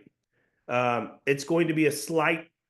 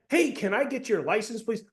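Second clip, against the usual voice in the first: much higher, eager-to-please question; un-coplike polite asking; 8 semitones higher than usual. Shown as a demonstration. Coach showing the wrong cop tone, high and over-polite. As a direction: ask it timidly high, like a favor. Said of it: "Do cops ever do that?".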